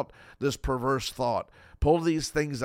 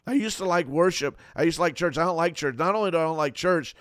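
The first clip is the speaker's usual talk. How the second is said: whiny complaint; faster than usual. Retold or imitated. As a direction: grumble like someone tired of church. Voicing a discouraged believer.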